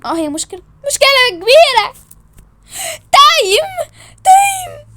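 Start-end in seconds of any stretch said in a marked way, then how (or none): 0.9-1.9 loud, high-pitched declaration; exasperated outburst. Acted. Insisting the problem is big, exasperated outburst. 3.1-4.9 very high, loud yelp; time-out shout. Acted. Calling time on the quarrel, high shout.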